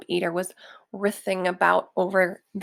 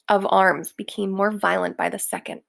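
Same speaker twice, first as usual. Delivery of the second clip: ordinary delivery, nothing marked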